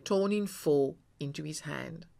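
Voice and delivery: ordinary delivery, nothing marked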